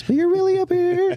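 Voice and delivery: mock falsetto, very high and loud; joking high voice. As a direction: leap up into a loud, exaggerated falsetto. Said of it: "This is your podcast voice".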